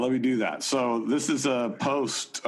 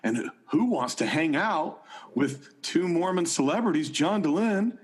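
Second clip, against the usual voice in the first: higher, upbeat pitchman voice; John Dehlin voice; 5 semitones higher than usual. Acted. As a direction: sell it like an eager podcast host. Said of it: "in your John Dehlin voice".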